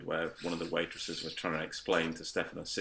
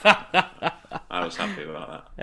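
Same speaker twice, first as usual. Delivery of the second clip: laughing; 10 dB louder than usual. Real, not acted. Laughing at free sausage rolls.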